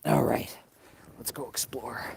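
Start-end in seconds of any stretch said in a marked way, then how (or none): 1.2-2.0 hushed, breathy whisper as he sets off. Real, not acted. Vlogger whispering to viewers as he sneaks off, hushed. Sneaking onto private snowy land.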